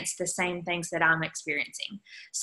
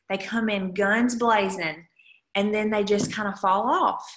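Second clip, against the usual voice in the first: ordinary delivery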